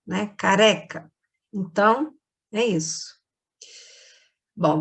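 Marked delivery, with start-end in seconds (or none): 0.4-1.1 "Careca" with a quick tapped R; soft R. Shown as a demonstration. Teacher repeating the example of R brando, "Careca" with soft R. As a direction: let the tongue flick once in "Careca". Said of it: "a gente chama de R brando".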